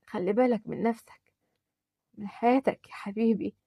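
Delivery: fading out with a last breath of love; dying whisper-soft farewell. Acted. Dying mother softly bidding her son farewell.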